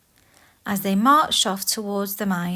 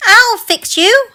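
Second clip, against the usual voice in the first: much higher, loud hag shriek; witch shriek; 13 semitones higher, 11 dB louder than usual. Acted. Queen atop the cliff threatening dwarfs, witch shriek. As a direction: shriek it in fury. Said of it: "she shrieked".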